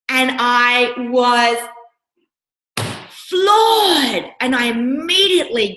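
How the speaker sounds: shocked. Retold or imitated. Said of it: "got really pissed off and hurt".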